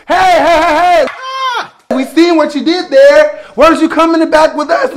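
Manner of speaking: high, loud calls of hey; store workers calling out. Retold or imitated. Secret shoppers stop her at the door, calling out. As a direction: holler after the shoplifter. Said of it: "I hear two people".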